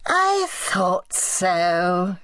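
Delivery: whining, creaking aunt. Acted. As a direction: creak it out like a frail old tyrant. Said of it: "Her voice whined and creaked".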